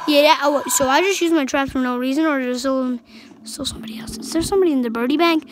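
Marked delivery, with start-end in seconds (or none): 3.4-4.3 whisper. Real, not acted. Hiding in the game.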